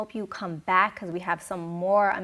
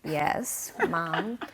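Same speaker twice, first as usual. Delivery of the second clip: imitating a daughter humoring her mom; mock-patient reply; 4 dB louder, much slower than usual. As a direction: draw out a reluctant yes, rising on the end. Acted patient reply to worried mom.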